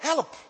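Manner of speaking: "Help" said high and clipped short. Shown as a demonstration. Preacher showing the shortest word, a sharp high "Help". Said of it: "help is ook het kortste woord".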